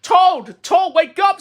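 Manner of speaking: high, loud call to wake someone. Acted. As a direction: call him awake. Frog waking Toad.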